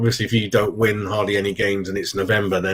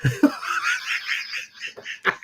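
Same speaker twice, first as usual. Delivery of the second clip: quiet breathy laugh; 11 semitones higher, 6 dB quieter than usual. Real, not acted. Laughing to himself.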